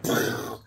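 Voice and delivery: breathy "pfft" burst of an impact; mouth-made explosion into the ground; imitated impact sound. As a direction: puff out the crash as a sound effect. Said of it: "It's like".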